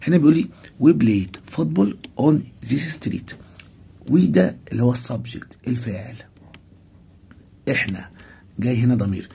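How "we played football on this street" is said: example sentence read very slowly and clearly. Shown as a demonstration. Teacher reading the English example to students, very slow. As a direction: spell it out slowly for the class. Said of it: "reads example sentence slowly".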